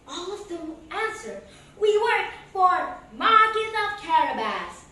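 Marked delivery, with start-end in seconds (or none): 1.8-4.9 louder answer in chorus; workers answering. Acted. Land workers answering the king, loud. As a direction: answer together proudly. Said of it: "they all answered".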